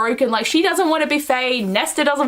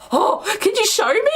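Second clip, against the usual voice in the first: high, hammed-up request; mock awed voice; 8 semitones higher than usual. Rhys asking Feyre to show him, high mock awe. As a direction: ask in an exaggerated high voice.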